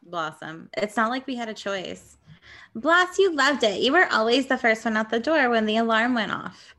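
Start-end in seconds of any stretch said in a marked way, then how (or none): none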